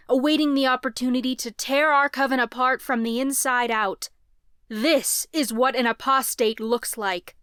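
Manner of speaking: loud, booming public address; ringing proclamation. A narrator's reading. Leader proclaiming to the crowd over the square, booming. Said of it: "his voice rang out over the square".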